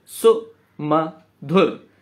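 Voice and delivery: सुमधुर spoken very slowly, syllables apart; slow syllable count. Shown as a demonstration. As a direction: drag सुमधुर out to show its beats. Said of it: "अब देखिए". (सुमधुर बहुत धीरे, अक्षर अलग-अलग)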